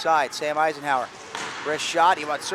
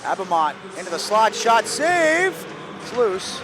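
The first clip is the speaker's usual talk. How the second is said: excited call; 6 semitones higher, 5 dB louder than usual. Real, not acted. Shot and save.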